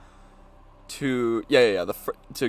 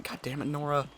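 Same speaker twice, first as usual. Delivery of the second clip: muttered curse; 10 dB quieter than usual. Real, not acted.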